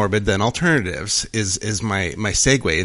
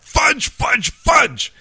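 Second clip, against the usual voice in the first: high, exasperated repeated shouts; worked-up yelling; 3 dB louder, much slower than usual. Acted. Priest cursing his golf game, worked-up yelling. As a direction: let the frustration burst out on each word. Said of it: "He's just getting worked up".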